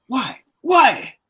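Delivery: rising to a loud, high, outraged cry; mock Conte pleading in frustration; exasperated why. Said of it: "he said".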